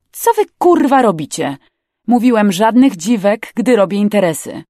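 loud scolding that starts high; angry rebuke. Acted. Captain berating crew over a woman aboard, angry. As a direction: tell them off forcefully.

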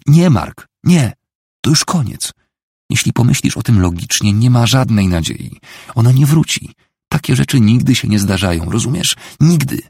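high, loud, emphatic denial; desperate insistence. A narrator's reading. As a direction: hammer home that hope is gone. Desperate wife argues all hope is gone.

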